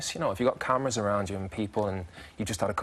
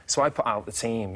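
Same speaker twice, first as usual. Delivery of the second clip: ordinary talk, nothing marked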